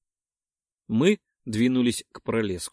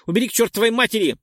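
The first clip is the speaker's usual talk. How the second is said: much higher, louder, fast yell; panicked yell; 10 semitones higher, 6 dB louder, much faster than usual. Acted. Dex screams at Perk to get rid of it, panicked yell. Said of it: "завопил Декс".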